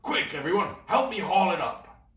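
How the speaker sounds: urgent shout. Acted. Captain Claw urging crew.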